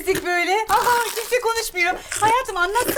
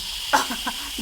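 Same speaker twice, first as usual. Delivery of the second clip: scoffing laugh; 7 semitones lower, 5 dB quieter than usual. Acted. Wife laughs off the idea.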